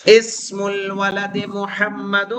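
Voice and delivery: slow, deliberate textbook reading; slow Arabic reading. Shown as a demonstration. Teacher reading from the book for students, slowly. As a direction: spell the phrase out at dictation speed. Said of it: "ارے وہاں دیکھو بھائی، کتاب میں".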